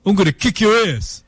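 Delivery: hammed-up tough New York accent; New York tough-guy impression; mock New York accent. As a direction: put on a New York threat, played for laughs. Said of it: "New York is like".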